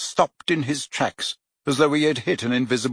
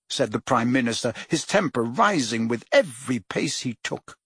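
ordinary delivery, nothing marked

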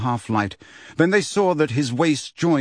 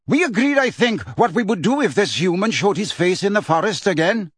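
high, raised, indignant centaur voice; nasty, hostile; 7 semitones higher than usual. A narrator's reading. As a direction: confront him with a nasty edge. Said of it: "with a nasty inflection in his voice".

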